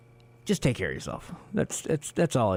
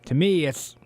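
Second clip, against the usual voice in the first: usual speaking voice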